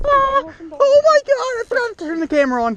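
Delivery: sudden high panicked yelp; panicked outburst. Real, not acted. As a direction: blurt it out in alarm. Panics on realizing camera off.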